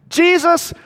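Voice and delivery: high, loud shout of "Jesus!"; yelled call. Retold or imitated. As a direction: call out over a crowd. Man yells out from the crowd.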